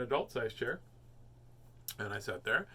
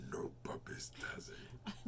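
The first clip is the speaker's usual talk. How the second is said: whispery voice; 8 semitones higher, 13 dB quieter, much slower than usual. Retold or imitated. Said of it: "Sound like when you go".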